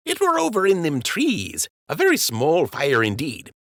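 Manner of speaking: high-pitched, downplaying the trouble; high dockhand voice making light of a fire; sheepish dockhand. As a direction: make it sound small and harmless, up high.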